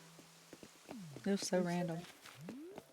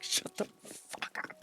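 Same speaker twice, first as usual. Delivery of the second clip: muttered shut up; 4 dB quieter, much slower than usual. Retold or imitated. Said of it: "I'd be like".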